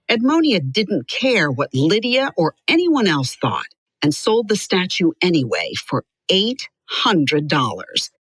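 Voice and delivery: usual speaking voice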